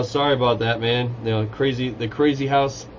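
ordinary delivery

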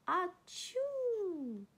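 big, high-pitched achoo; acted sneeze. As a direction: let out a long, falling storybook sneeze.

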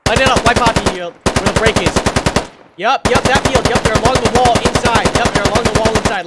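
high, loud urgent shouting; alarm shout. Real, not acted. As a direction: bark fire orders over gunfire. Urging fire on enemy.

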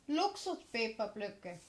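ordinary talk, nothing marked

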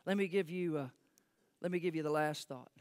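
ordinary talk

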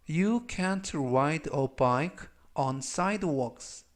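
Don't be worried about that, word by word, very slowly; very slow model reading. Shown as a demonstration. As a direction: read it out very slowly for students to copy. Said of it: "역시 천천히 세 번씩 읽어드리고요".

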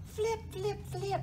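high, light flipping sounds; high storybook sound effect; flapping sound words. A narrator's reading. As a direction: flick each word up high. Narrator imitates flapping.

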